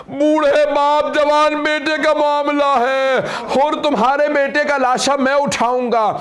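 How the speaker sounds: keen the tragedy out to the mourners; mournful preaching at full force. Acted. Climactic lament recitation.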